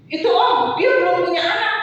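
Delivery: high, eager tattling; nosy friends. Retold or imitated. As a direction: pipe up on a colleague's behalf. Nosy friends tell on the pastor.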